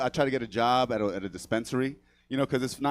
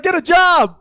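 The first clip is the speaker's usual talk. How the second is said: high, full-volume yell; heckling shout; 11 dB louder, slower than usual. Retold or imitated. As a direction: yell it in at the performer from the street. Homeless heckler yelling into show.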